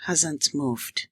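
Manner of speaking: measured, dramatic storytelling voice; story narration. A narrator's reading. Language teacher opening the lesson with a story, narrated dramatically.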